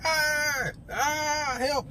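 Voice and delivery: high pained cry for help; retold scream. Restrained man crying out for help, high. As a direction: cry out high like he's being held. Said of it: "He's screaming".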